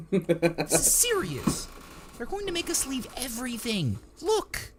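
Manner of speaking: high, whining, urgent techie; whiny. A narrator's reading. Techie warning squabbling troopers, high urgent whine. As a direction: plead shrilly to be taken seriously. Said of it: "Tink's inherently whiny voice".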